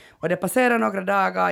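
normal talking voice

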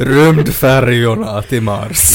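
loud, slow words through laughter; real laughter, drawn-out and loud; laughing. Real, not acted. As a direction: finish the punchline while cracking up. Finishes joke while laughing.

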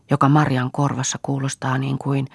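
ordinary delivery

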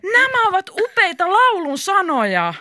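high, drawn-out excited cry; high-pitched, stretched-out announcement; excited exclamation. A narrator's reading. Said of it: "hän huudahti".

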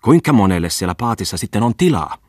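an irritated wife snapping at her husband; angry question. A narrator's reading. Wife demands of her husband how many fit, angry. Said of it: "kysyi vihaisesti".

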